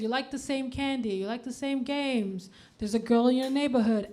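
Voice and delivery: usual speaking voice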